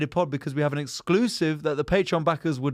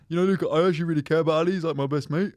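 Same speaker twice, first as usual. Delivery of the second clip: quick, lightly put-on confiding voice; light mimicry of a gushing mate; impression of co-host; faster than usual. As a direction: imitate him confessing in a rush. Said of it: "You said this to her".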